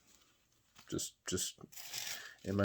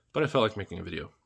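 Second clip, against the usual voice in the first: usual speaking voice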